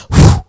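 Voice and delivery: loud mimed whoosh of a blast of air; a big rushing whoosh. Retold or imitated. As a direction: blow it out like a sudden gust. Air blast in her story.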